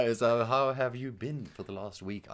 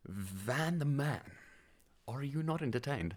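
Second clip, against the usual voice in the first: slow dramatic delivery; 4 semitones higher, 7 dB quieter, slower than usual. Pretend.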